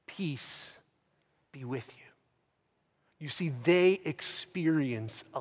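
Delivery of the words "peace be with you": very soft, near-whispered greeting; hushed blessing. Retold or imitated. Jesus walks in on the afraid disciples, hushed blessing. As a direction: offer peace gently, almost under the breath.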